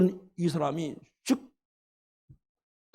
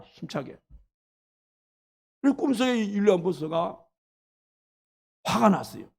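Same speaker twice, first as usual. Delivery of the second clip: normal talking voice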